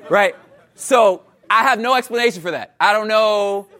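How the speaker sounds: ordinary talk, nothing marked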